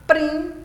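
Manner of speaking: "prim" alone, high and loud; isolated stressed syllable. Shown as a demonstration. Teacher isolating the tonic syllable, "prim" high and emphatic. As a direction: punch out "prim" by itself. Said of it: "é a antepenúltima".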